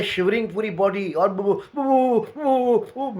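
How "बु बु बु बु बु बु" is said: put-on higher, louder stuttering of a terrified girl; terrified stammer. The girls shivering with fright, imitated as a terrified stammer. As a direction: stammer out the sound like she is shaking with fear. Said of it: "यही आवाज़ इनके मुँह से निकल रही".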